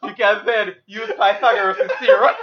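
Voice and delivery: laughing. Real, not acted. Mock math explanation, laughing.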